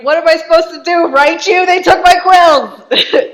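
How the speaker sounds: very high, very loud mock rage; hammed-up furious shout. Marquis raging about stolen quills, hammed-up furious shout. Marquis's outburst at wife peaks.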